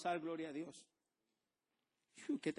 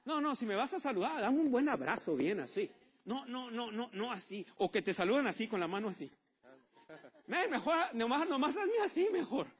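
higher, louder teasing protest about weak hugs; hammed-up loud mockery; 6 semitones higher, 7 dB louder than usual. Pastor teases the church about weak hugs, higher and louder. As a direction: exaggerate the complaint, half laughing.

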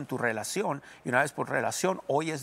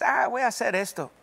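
devil's inner voice; 8 semitones higher, 4 dB louder than usual. Acted. Voicing the devil's inner suggestion.

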